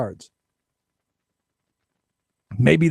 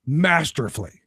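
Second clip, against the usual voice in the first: usual speaking voice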